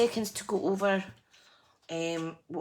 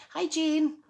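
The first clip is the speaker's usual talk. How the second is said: high, cheery hello to a viewer; bright greeting; 12 semitones higher, 6 dB louder than usual. Real, not acted. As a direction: sing out a happy hello.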